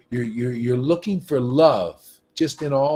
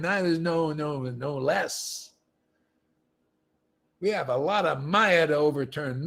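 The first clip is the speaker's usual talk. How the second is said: ordinary talk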